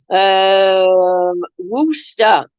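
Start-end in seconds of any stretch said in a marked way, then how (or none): 1.6-2.5 Worcester said short as ROOS-ter, two syllables; correct pronunciation. Shown as a demonstration. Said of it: "is how it's spoken".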